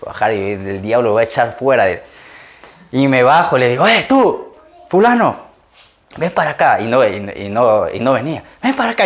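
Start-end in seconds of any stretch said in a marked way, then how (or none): 3.9-6.8 high-pitched mock hail to someone; imitated calling out. Retells calling out to a man. 8.6-9.0 high-pitched re-enacted summons; imitated insistent call. Retells calling after fleeing man.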